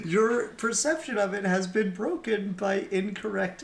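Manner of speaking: high, loud, emphatic mock scolding; mock-indignant lecture. Podcaster correcting imagined moviegoers' idea of ninjas, loud mock indignation. Continues the mock rebuttal to viewers.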